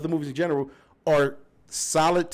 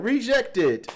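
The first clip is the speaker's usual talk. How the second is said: higher, flat machine announcement; robotic computer voice; 8 semitones higher than usual. Retold or imitated. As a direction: say it like a scanner rejecting. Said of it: "be like".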